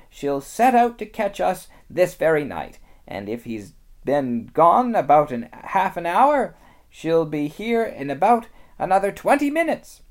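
high, loud motherly beaver voice; lightly voiced anxious mother beaver; Missus Beaver. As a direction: scold the children urgently.

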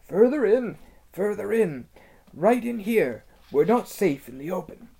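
high, hoarse beaver whisper; hoarse throaty whisper. Acted. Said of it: "in a hoarse, throaty whisper".

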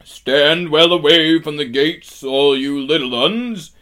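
high, loud giant bellow; warning giant. A narrator's reading. Giant clears small creatures from the gates, booming warning. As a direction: bellow a warning to those far below.

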